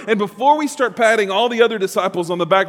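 ordinary delivery

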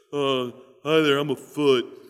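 dopey put-on voice for a foot; comic character voice of a humble foot; talking foot voice. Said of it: "I tried to give the foot a voice".

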